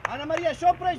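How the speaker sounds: loud shouted name; real sideline shout; calling out. Real, not acted. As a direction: yell at a player to get her attention. Coach calling a player.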